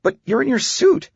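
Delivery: high, disbelieving objection to dad; incredulous daughter. Acted. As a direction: protest in a high girlish voice. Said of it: "recalling her incredulous reaction".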